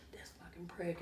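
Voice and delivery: whispered, seething under the breath; whispered curse. Retold or imitated. Ex-cop Kevin grumbling about the tour manager, whispered. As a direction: hiss it like a muttered insult. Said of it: "He's like".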